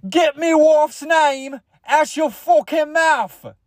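high, slow, hammed-up British outburst; British accent. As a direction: stretch it into a very high mock-British yell. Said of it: "what if he was British?".

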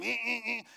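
vocal imitation of a vibrating phone; buzzing phone imitation. Preacher imitating his phone vibrating, buzzing hum. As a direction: buzz in short repeated hums. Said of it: "Started vibrating in my phone".

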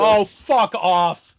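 loud, high, drawn-out disbelief; exasperated outburst. Real, not acted. Host hears guest praise the awful show, loud exasperated outburst. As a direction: blurt it out, stretching each word.

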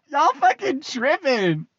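slow, amused, high; laughing dismissal. Real, not acted. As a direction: laugh them off slowly.

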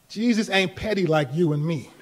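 ordinary talk

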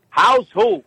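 high, puzzled mock mother; hard-of-hearing mother. Mother who misheard her son's greeting, high confused voice. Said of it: "what she thinks you just said".

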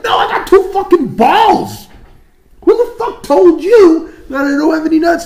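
high, loud outraged retort; indignant protest. Retold or imitated. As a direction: protest loudly, hammed-up outrage. Retold quarrel over the rumour.

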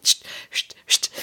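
tonloses Scht-Scht nachgemacht; imitiertes Schüttgeräusch. Retold or imitated. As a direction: mach das Schwappen mit Zischlauten nach. Wasser ins Klo schütten, Geräusch. (zischendes Wassergeräusch nachahmen)

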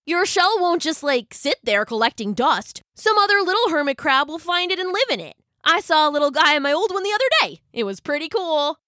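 high, quick storybook chatter; excited. A narrator's reading. Crab telling his friend about shells' new owners, excited. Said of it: "said Brock excitedly".

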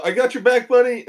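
high, loud rallying yell. Acted. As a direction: shout to back up your buddy. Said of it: "I'm gonna yell".

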